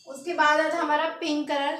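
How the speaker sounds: ordinary delivery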